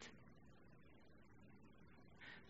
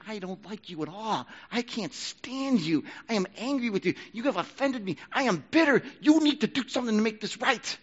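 higher and louder, slowed on "I can't stand you", building to "bitter"; mock bitter grudge; 8 semitones higher, 3 dB louder than usual. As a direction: spit out the grudge like a wounded churchgoer. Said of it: "Old life".

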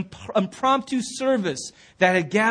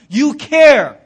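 You care, punched out much louder; Jesus pressing the point home, loud; forceful insistence; 3 semitones higher, 9 dB louder than usual. Acted. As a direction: hit care hard, like a command. Jesus voiced insisting, repeated.

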